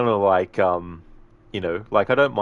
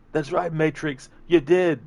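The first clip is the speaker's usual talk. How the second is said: high-pitched, pleading movie henchman; desperate captive pleading; 9 semitones higher, 5 dB louder, slower than usual. Acted. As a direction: plead for your life in a high voice. Captive pleads in acted movie scene.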